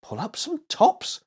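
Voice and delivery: amazed echo. A narrator's reading. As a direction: say it as if it can't be true. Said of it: "echoed Willie in amazement".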